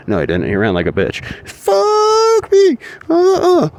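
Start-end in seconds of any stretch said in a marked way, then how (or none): 1.5-3.7 shrill squeal. Pretend. Squealing exclamation.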